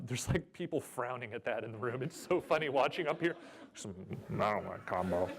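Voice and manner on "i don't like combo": mock grumpy player. Voicing frowning audience members.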